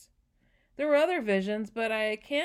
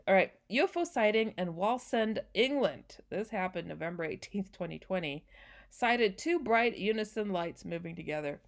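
usual speaking voice

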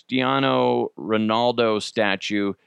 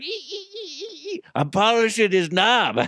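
squeaky silly voice; 10 semitones higher than usual. Pretend. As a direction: squeak like a giddy tourist. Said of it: "I'd be like".

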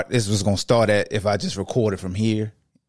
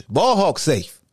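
normal talking voice

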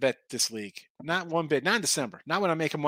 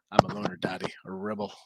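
lower, softer movie quote; deadpan loner line, lower; Pee-wee Herman; 8 semitones lower, 4 dB quieter than usual. Retold or imitated. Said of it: "Pee Wee Herman".

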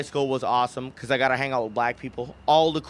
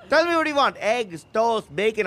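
mom waking son; 6 semitones higher than usual. Retold or imitated. Mom lists breakfast for son, mock motherly. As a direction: sing out the breakfast options like mom. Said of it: "she's waking me up".

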